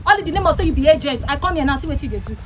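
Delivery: ordinary delivery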